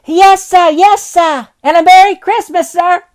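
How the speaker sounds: put-on high, bright young lad's voice; a cocky boy calling up to the window; cheeky street boy. Boy thanks Scrooge.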